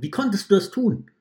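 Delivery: the wolf, betrayed, shouts at the catcher; angry outcry. Acted. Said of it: "rief der Wolf". (empörter, lauter Aufschrei)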